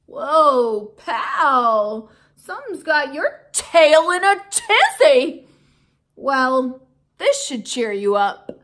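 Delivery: loud, booming buddy voice; hearty. Acted. Aldo comforting the upset hamster, loud and hearty. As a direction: boom it out like a big friendly guy.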